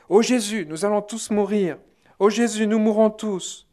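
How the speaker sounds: high, loud terrified cry to Jesus; panicked cry. Retold or imitated. La foule terrifiée crie à Jésus, cri paniqué aigu. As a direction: cry out as if the sun will crush you. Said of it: "On criait".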